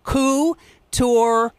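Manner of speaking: couturier drawn out slowly, koo-tor; sounding out couturier. Shown as a demonstration. Director reading the cast's cue card aloud, drawn out. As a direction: read couturier off the sign, slowly. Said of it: "a sign that wrote out".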